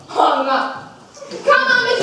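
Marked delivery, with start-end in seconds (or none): 1.4-2.0 taunting call. Retold or imitated. Coaxing Judas.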